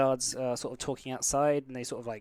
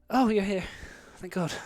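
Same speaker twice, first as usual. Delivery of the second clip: put-on relieved old lady; 7 semitones higher than usual.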